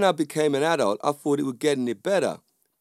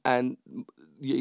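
normal talking voice

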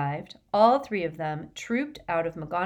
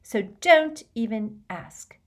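firm, slow and louder refusal; flat refusal; 5 semitones higher, 5 dB louder, slower than usual. A narrator's reading. Hermione refusing to discuss Ron with Harry, flatly. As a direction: shut the subject down word by word. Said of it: "she said flatly".

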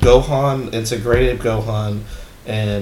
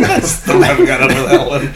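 high laughter then talking through it; can't stop laughing at the joke; laughing hard; 5 semitones higher, 5 dB louder than usual. Real, not acted. Cracking up at granola joke.

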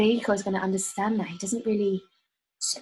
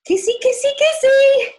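much higher, cooing kisses; mock kissy voice; 11 dB louder than usual. Singer signing off her letter to Federico in a high cooing kissy voice. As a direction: blow kisses with the voice in a high coo.

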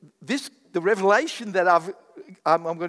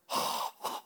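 mock gasp; 8 dB quieter than usual. Reacting to paying five thousand.

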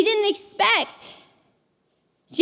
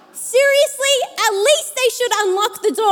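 griping about the locked door, fed up; angry complaining; 7 semitones higher than usual. Retold or imitated. Grumbling at the locked door, fed up. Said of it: "I'm angry".

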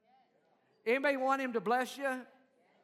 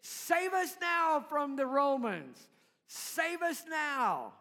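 raised, slow crowd plea to be rescued; crowd pleading; 5 semitones higher, 4 dB louder, slower than usual. Retold or imitated. Crowd begging to be freed from the Romans, loud and slow. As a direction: draw it out like a crowd hungry for a king.

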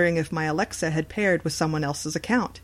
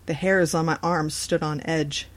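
usual speaking voice

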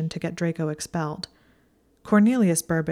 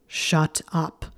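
snarled. A narrator's reading. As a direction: bite it off with a growl. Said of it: "Draco snarled".

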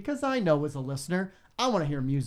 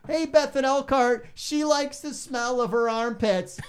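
mock DJ announcement; 8 semitones higher, 3 dB louder, slower than usual. Host mock on-air announcement.

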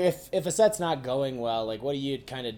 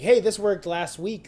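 excited; 7 semitones higher, 7 dB louder, slower than usual. Retold or imitated. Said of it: "you're just, like, so excited".

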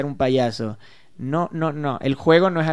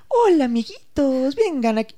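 higher, slow, drawn-out sugary hello; mock kids' entertainer; 10 semitones higher than usual. Mocking adults who talk down to kids, slow sugary. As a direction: stretch the hola sweetly, much higher. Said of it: "Tú nunca me vas a ver así como".

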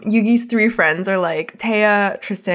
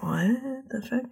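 stunned disbelief; 2 semitones higher, 10 dB quieter, slower than usual. Retold or imitated. Kaiba stunned by the transformation.

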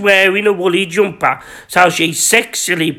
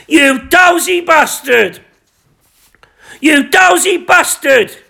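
slow, higher shouted insult; shouting; 6 semitones higher, slower than usual. Acted. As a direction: yell it out in outrage. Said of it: "Gary whispered".

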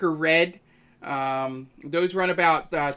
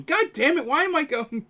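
exasperated outburst; 11 semitones higher than usual. Real, not acted. GM catches himself in accent.

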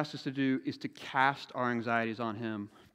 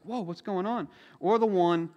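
normal talking voice

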